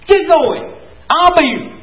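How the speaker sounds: high, loud repeated command. Acted. Preacher echoing God's order, loud and high. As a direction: bark it out as God.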